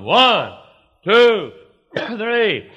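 high, loud counting with falling swoops; showman's count. Retold or imitated. Bible school balloon act, counted out high and loud. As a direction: call out each number big for the children.

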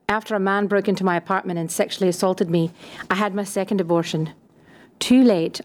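ordinary talk, nothing marked

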